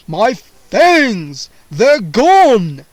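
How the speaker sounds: very loud vampire cry of loss; panicked vampire. A narrator's reading. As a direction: wail about lost fangs. Vampire panics over fangs.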